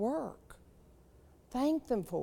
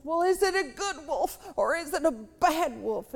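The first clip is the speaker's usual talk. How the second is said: put-on higher, louder young boy's voice; boy questioning his grandpa; curious grandson; 6 semitones higher, 5 dB louder than usual. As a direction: play the eager little grandson asking.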